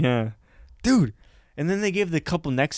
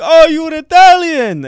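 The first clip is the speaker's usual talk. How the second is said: big, slow, delighted shout of welcome; hamming up the owner's overjoyed welcome; excited loud greeting; 13 semitones higher, 11 dB louder, slower than usual. Retold or imitated. Owner thrilled to meet fellow Italian, loud and slow.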